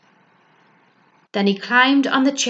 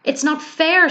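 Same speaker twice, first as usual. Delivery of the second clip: high, louder sob-choked complaint; sobbed; 8 semitones higher, 4 dB louder than usual. A narrator's reading. Bear bursts into tears before the owl, sobbing. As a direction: cry it out in frustration. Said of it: "sobbed the bear".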